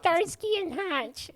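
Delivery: mocking nerd voice; nerdy kid voice. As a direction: ask it like a timid nerdy clerk. Mimicking nerdy clerk.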